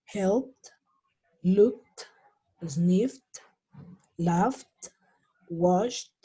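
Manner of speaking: "helped, looked, sniffed, laughed, washed" each one syllable ending in T; word by word, crisp T closing "helped" through "washed"; final T sound. Shown as a demonstration. Teacher models example list, "helped" to "washed" each ending in T. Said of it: "se van a pronunciar en pasado con una T".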